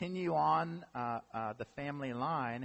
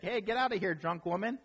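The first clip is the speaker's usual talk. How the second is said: mock priest shooing her off, pitched much higher; higher, hammed-up brush-off from the priest; gruff priest; 6 semitones higher than usual. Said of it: "the priest is like".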